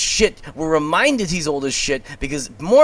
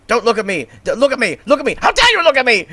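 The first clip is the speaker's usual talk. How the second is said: imperious outrage; 6 semitones higher, 3 dB louder, faster than usual. Retold or imitated. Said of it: "Mormont serving us Olivia Colman realness".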